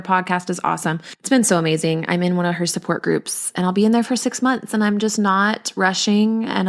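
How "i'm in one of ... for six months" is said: usual speaking voice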